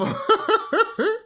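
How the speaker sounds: sudden high giggle; high laugh. Real, not acted. Vlogger laughing after telling them off, high laugh.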